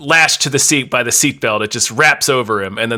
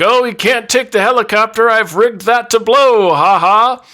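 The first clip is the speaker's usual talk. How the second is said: put-on taunting young man; 10 semitones higher, 4 dB louder than usual.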